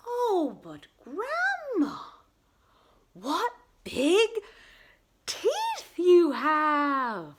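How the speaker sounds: high, loud, drawn-out astonishment. Acted. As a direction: build slowly to the big reveal.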